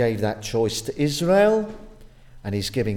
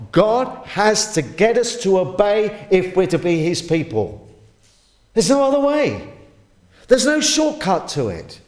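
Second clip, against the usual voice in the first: higher and louder, driving hard at the congregation; fervent preaching; 7 semitones higher, 5 dB louder than usual. Acted. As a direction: preach it full force, no letting up. Preaching at full force.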